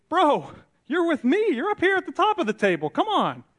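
a host calls a humble guest up, excited; eager welcoming host. Retold or imitated. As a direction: greet him like a thrilled buddy. Host welcoming guest to top seat.

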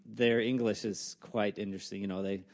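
usual speaking voice

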